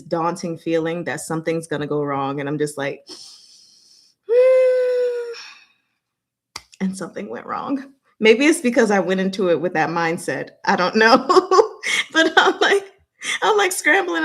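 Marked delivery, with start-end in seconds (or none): 4.3-5.4 a loud, high groaning sigh of dread. Real, not acted. 11.3-14.2 flustered laughing. Real, not acted. Laughing at herself.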